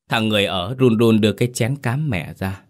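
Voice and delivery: usual speaking voice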